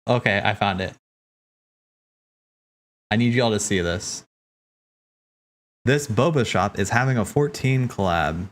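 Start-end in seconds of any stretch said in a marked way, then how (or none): none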